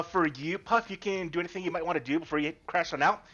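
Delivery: normal talking voice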